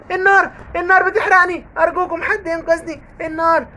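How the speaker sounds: high, panicked wailing cries; burning ghost screams. Acted. Ghost burning from the Quran, high panicked cries. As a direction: shriek as if burning alive.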